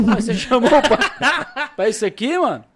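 cracking up while telling it; laughing. Real, not acted. Father laughs retelling his son's crypto loss, laughing loud.

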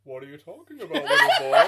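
put-on American Santa, rising to a loud high finish; indignant hammed-up Santa with American accent; bad American Father Christmas. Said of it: "He's American?".

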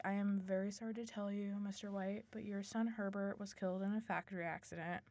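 soft, level acted condolence; somber condolences. Voicing bearer of bad news.

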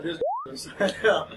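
high, giggly quoted line; laughing quote. Retold or imitated. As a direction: crack up mid-sentence. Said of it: "He said".

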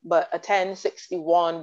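ordinary delivery

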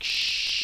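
soft, drawn-out "shh"; hushing shush. Acted. Teller spots the dragon's tail, hushed shush.

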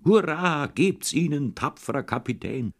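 high ringing cheer, fading at the end; rally the captain like a boy playing war; childish war whoop, high then fading; excited battle cry. A narrator's reading. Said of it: "Und er hat wieder geschrien".

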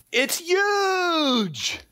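very high, drawn-out mock exclamation; Donald Trump. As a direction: stretch it into an over-the-top parody. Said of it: "guys aren't Trump fans".

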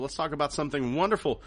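ordinary delivery

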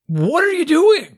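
high, loud, drawn-out exclamation; mock blowing your top, slow and high; exasperated outburst. Parent imagining losing it over blank homework, exasperated. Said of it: "not blow my top".

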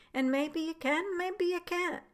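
lifted pitch, rising on can. Shown as a demonstration. Repeating his request to the doctors, pitched high. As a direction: toss the maybe lines up high, musing. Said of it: "Again, I say".

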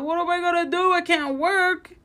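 high-pitched mock panic, close to tears; retold panic crying. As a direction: replay the panic with the voice climbing up. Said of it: "I was crying".

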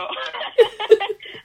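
a high, loud burst of real laughter. Real, not acted. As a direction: laugh out loud, bright and sudden. Laughing at advisor's doubt.

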